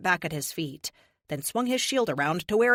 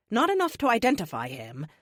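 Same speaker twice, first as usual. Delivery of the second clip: ordinary delivery, nothing marked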